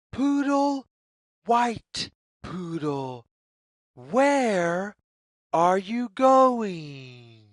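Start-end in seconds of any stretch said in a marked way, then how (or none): none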